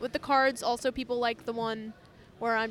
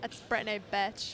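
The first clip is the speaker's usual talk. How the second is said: put-on Valley girl voice; 4 dB quieter than usual. Shown as a demonstration. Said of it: "You gotta say it like a Valley girl".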